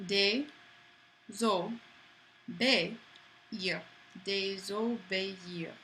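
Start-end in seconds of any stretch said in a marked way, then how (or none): 0.1-3.8 De, zo, be, ir split into slow separate beats; syllable by syllable. Shown as a demonstration. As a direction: sound it out one syllable at a time. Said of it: "Syllable by syllable, just like you were a kid".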